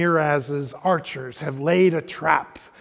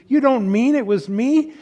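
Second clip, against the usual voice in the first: put-on higher, girlish, anxious pleading; a guilty child begging not to be blamed; young Lucy, pleading; 6 semitones higher, 4 dB louder than usual. A narrator's reading. Reading Lucy's plea to the lion, high and anxious.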